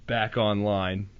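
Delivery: ordinary delivery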